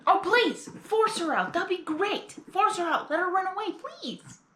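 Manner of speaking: high, mocking "please, force her out"; sarcastic. Real, not acted. Viewer talking back to the changeling guard, sarcastic. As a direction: egg the villain on with sarcasm.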